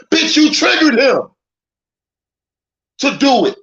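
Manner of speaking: high, loud accusation; indignant shout. Pretend. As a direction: yell it as a scolding call-out. Scolding the wife in the story.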